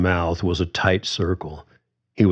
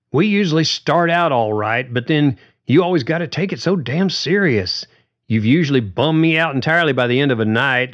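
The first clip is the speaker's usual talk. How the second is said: higher, pushed, a touch of heat; Carly going at it; 6 semitones higher than usual. A narrator's reading. Carly accusing George of spoiling the gigs, mild heated voice. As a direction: let the complaint spill out fast. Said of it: "then went at it".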